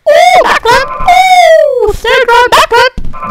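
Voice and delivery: loud, very high squealed stunt calls; excited whooping. Real, not acted.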